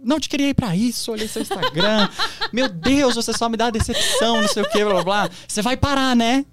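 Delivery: high, laughing mock of a scolding mother; imitating his mother. Son mocking his upset mother's scolding, high and laughing. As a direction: parody his disappointed mom through laughter. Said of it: "minha mãe chorando".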